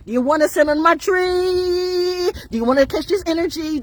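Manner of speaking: high, stretched-out challenge on TREE. Acted. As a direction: hold TREE long and high. Repeats question as drawn-out taunt.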